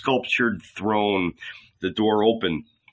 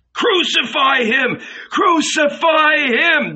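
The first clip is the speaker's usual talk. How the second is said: very high, loud screamed chant; mob howling; 5 dB louder, slower than usual. A narrator's reading. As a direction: scream it like a crowd demanding blood. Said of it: "The people began to howl".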